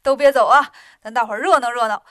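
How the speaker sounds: loud, fast, delighted invitation; happy laughing call. A narrator's reading. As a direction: invite everyone joyfully to stay. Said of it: "这回镇元大仙高兴了". (镇元大仙高兴地大声招呼大家)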